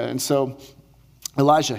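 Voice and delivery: normal talking voice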